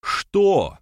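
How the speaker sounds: high, loud, indignant interruption; cutting in. A narrator's reading. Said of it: "перебил его Берамир". (резко перебивая, возмущённо)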